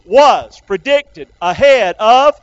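slow, word by word, punched; call for repetition. Shown as a demonstration. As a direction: lead the crowd through each word. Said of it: "say it with me".

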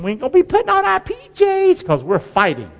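a wall builder bragging about not primping; voicing builders. Preacher voicing Nehemiah's workers refusing to change, excited.

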